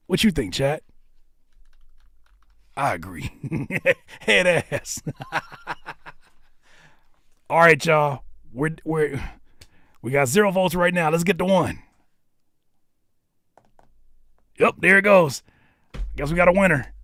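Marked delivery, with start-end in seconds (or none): 2.8-5.3 amused words breaking into laughter; laughing. Real, not acted. Host joking with chat, laughing. 7.5-16.9 raised, loud vote-count call; loud announcing. Real, not acted. Host announcing votes to chat, loud and raised.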